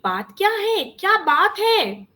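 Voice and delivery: high, insistent questioning, stretched at the end; concerned questioning aunt. A narrator's reading. Aunt asking what is the matter, high and insistent.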